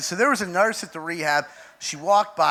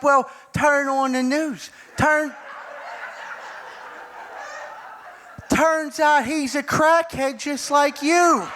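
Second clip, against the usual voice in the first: higher, slow, drawn-out uncle voice; uncle impression; 7 semitones higher, slower than usual. Uncle breaking the news to his nephew, slow needling. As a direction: spell it out slowly in a raised pitch. Voicing Uncle Jim on the phone.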